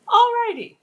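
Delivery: loud high-pitched alrighty. Real, not acted. Back to camera after sons.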